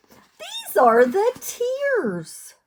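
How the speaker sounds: slow, high whimpering read; a pretend sob in the voice; mock crying. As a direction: act out the tears for the kids. Book page shows girl crying.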